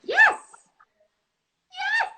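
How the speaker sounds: very high squealed yes; piercing little put-on voice. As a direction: squeal it out in a silly falsetto. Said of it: "Why don't do that voice".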